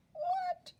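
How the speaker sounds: very high shocked what; astonished. Real, not acted. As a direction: squeak it in disbelief. Astonished at ten feet.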